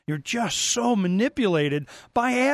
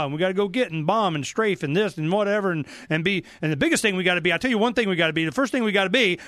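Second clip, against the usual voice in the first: ordinary talk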